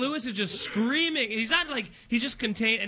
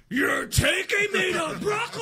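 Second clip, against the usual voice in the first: high, slow, drawn-out mock scream; loud sustained yell; 8 semitones higher than usual. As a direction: bellow in disbelief, dragging out the last word. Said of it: "sustained yell".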